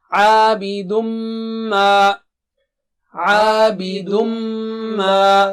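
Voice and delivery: "Abidum ma" very slow, the m hummed long; sung "Abidum ma" with a long nasal m; ghunna on mim. Shown as a demonstration. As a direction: hold the nasal m of "Abidum ma" double length. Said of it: "gunna is there on mim".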